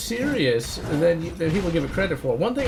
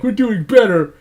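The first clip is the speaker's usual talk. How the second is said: mocking voice; 3 semitones higher, 4 dB louder than usual. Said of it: "people on the internet would be like".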